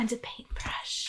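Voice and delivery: slow ASMR whisper. Acted. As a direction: breathe it softly.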